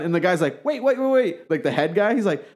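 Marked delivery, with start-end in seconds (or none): 0.6-1.5 high, hurried-sounding objection; retold panicky interruption; mock protest. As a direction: cut in as the alarmed boss, imitated. Head guy objects in retold skit.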